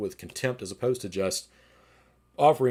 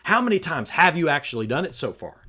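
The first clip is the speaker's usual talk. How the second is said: contemptuous husband; 5 semitones higher, 4 dB louder than usual. Retold or imitated. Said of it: "He said".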